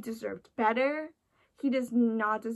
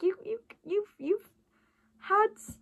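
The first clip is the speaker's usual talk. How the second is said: higher, stammering voice of her own brain; nagging inner voice; 10 semitones higher than usual. Retold or imitated. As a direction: play your brain calling you out. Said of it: "my brain's like".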